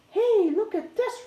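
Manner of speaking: put-on a boy calling out as he spots something; excited shout. A narrator's reading. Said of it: "Russell shouted".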